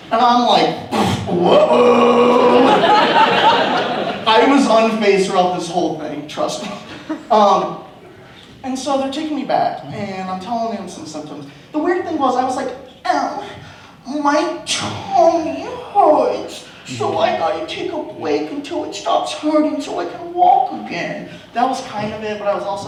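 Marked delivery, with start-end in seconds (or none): 1.3-2.7 loud, raised mild whoa; lightly voiced amazement; mock surprise. Mock surprise at blood spurt. 2.7-4.2 high unvoiced laugh; real breathy laugh, high. Real, not acted. 13.1-21.3 put-on high, slow childish whine; high, drawn-out babyish whining; whiny tummy ache. Childlike complaint of pain.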